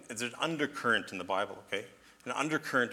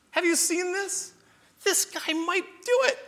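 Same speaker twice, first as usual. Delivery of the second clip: very high, drawn-out amazement; God squealing with delighted disbelief; excited; 5 dB louder, slower than usual. Acted. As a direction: burst with hope, high and slow. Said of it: "He almost sounds excited".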